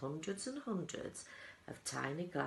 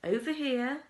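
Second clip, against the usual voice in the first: high, loud beckoning; excited call; 9 semitones higher, 8 dB louder than usual. A narrator's reading. Wonka excitedly calling the party across the room. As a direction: wave them over with glee. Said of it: "skipping excitedly".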